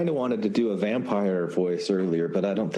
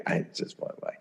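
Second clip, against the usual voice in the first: whisper; 7 dB quieter than usual. Real, not acted. Aside while reading letter.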